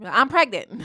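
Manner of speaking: sudden loud blurt; mock shock announcement. Woman shocking a man into listening, mock blurt.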